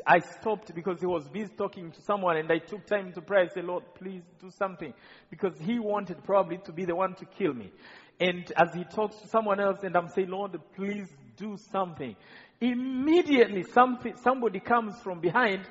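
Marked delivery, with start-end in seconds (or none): 3.6-4.9 barely audible plea to the Lord; a desperate murmured prayer; hushed prayer. Retold or imitated. Silent prayer before killer. 10.3-12.1 barely audible plea to the Lord; a desperate murmured prayer; hushed prayer. Retold or imitated. Silent prayer before killer.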